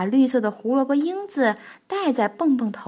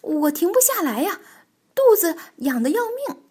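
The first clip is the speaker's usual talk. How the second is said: flustered; 6 semitones higher, 5 dB louder than usual. Acted. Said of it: "蹦蹦慌乱地挣扎着".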